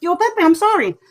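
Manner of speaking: high, sheepish apology to the ghost. Retold or imitated. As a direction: squeak out a sorry to Uncle Pepe. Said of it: "and it's just".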